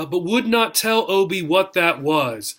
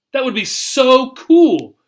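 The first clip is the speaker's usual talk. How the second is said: excited; 7 semitones higher, 6 dB louder than usual. Acted. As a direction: gush it like a thrilled kid. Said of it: "whenever she got really excited".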